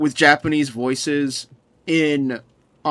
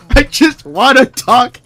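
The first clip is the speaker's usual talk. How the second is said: louder, slower laugh-through words; 6 semitones higher, 7 dB louder than usual. Real, not acted. Streamer confessing to chat, louder slow laughing words. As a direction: laugh it out slowly.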